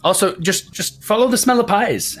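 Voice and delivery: a sly shopkeeper poking fun; baker woman Agatha. Acted. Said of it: "She goes".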